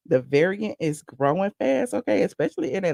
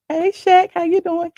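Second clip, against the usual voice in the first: louder, higher call to a celebrity; 9 semitones higher, 5 dB louder than usual. Retold or imitated. Host recalls greeting [name] at the ball, calling out. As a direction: hail him across the room.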